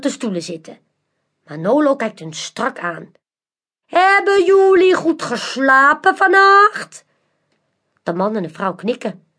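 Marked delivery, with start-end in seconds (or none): 3.9-7.0 high, slow, theatrical magician voice; mysterious magician. A narrator's reading. Magician Manolo invites the crowd, slow and theatrical. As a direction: lure the crowd in like a showman.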